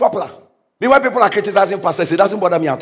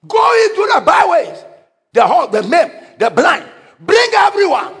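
preaching shout; 10 semitones higher than usual. Acted. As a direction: shout the call to the crowd. Preacher shouts master's command.

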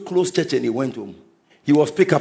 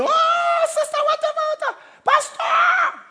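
much higher wailing widow voice; grieving widow. Retold or imitated. Widow wailing to the pastor, much higher crying voice. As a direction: cry it out like a bereaved wife calling the pastor.

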